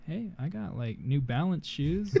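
very soft, hesitant mock-meek voice. Comparing his gift to his brother's new PC, meek. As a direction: offer it small, slowing toward the end. Acted modest reply after brother's gifts.

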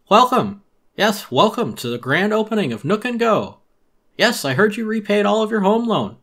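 high, loud, oily shopkeeper pitch; high, loud salesman voice full of fake cheer; Tom Nook voice. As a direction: sell it like a pushy merchant greeting a customer.

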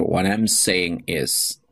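slow, careful model phrase; slow teacher demo of "What I'm saying is". Shown as a demonstration. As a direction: read it slowly for learners to repeat. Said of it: "you can say".

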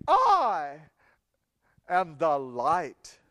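low, slow, weighty declaration as Jesus; solemn proclamation. Acted. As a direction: let the words land with authority. Jesus' words repeated, slow.

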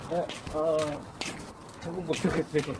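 slurring like a tipsy customer; pretend drunk. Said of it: "술 취한 척하고".

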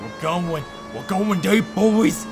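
loud and rising, hyped up; excited shout. Real, not acted.

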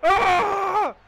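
usual speaking voice

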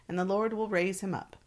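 ordinary talk

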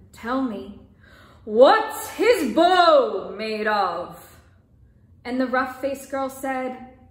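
measured, expressive storybook reading; story narration. A narrator's reading. Storyteller reading a folk tale aloud, calm narration. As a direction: tell the tale gently to listeners.

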